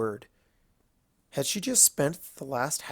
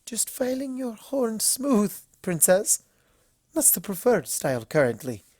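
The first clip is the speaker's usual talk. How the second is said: strained composure; 9 semitones higher than usual. Acted. Spa pony keeping calm before the princess, high and strained. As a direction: hold it together while smiling through nerves. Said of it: "Aloe struggled to keep her voice composed".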